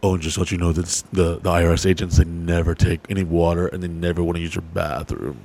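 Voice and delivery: low, quick lightly voiced accountant; imitating accountant. The accountant warning about IRS agents, lightly voiced. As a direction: tip them off in a low aside. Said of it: "our C P A, is like".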